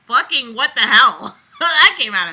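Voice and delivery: loud, laughing disbelief; shocked outburst. Real, not acted.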